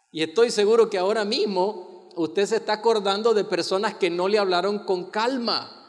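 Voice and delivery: usual speaking voice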